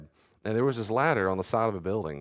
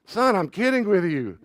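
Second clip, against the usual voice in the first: high and loud, half-laughing; laughing dad, higher and louder; amused dad; 11 semitones higher, 8 dB louder than usual. Retold or imitated. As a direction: tease him out of the joke. Dad retells laughing at son.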